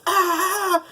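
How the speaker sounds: very high, loud cartoon monkey shriek; imitated monkey scream. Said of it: "and the monkey screams".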